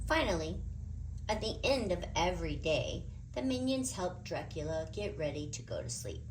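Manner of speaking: ordinary delivery, nothing marked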